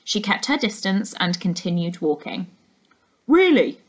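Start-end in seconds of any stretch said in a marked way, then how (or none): 3.3-3.8 higher, louder, pressing the word Really?, falling at the end; insisting. Acted. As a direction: push Really? back at her, insistent. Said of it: "insisted the man".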